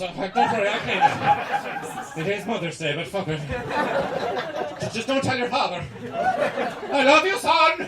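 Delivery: high, loud doting mother voice; mock Irish mammy. Irish mother reassures her son, high doting voice. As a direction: gush over your son like a mammy. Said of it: "your mother comes in with the marigolds".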